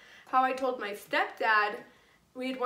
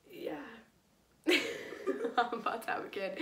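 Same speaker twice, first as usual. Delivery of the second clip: sheepish laugh; 3 dB quieter, slower than usual. Real, not acted. Admitting to stepdad, laughing.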